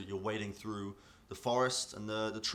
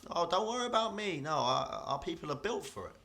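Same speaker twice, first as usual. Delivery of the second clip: higher-voiced, untroubled river dwarf; 9 semitones higher, 3 dB louder than usual. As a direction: brush off their worry as a proud dwarf.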